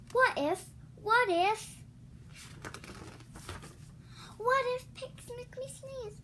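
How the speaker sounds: mock panic building; rising worry.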